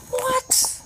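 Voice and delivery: squeaky what, very high; real stunned squeak; disbelief. Real, not acted. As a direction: squeak it in pure amazement. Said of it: "amazed at the luck".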